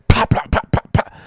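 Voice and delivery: quick, sharp "pop" noises; imitated popping sparks. Storyteller imitating sparks from a panel, sharp popping noises. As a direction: mimic sparks going off. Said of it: "we started seeing pops".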